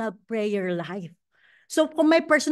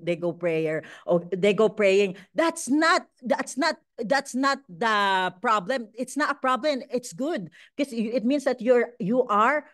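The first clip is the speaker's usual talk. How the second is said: normal talking voice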